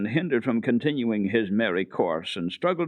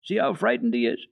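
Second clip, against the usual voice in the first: put-on girl crying out to stop Peter beating the goat; 12 semitones higher than usual. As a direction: stop him before he strikes. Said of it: "But Heidi cried".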